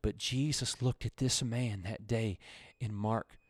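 ordinary delivery

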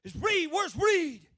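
loud, high commander's holler; yelling out. Retold or imitated. Commander bursting into squad bay, yelling. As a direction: bellow for the recruit across the room. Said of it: "he yelled out to me".

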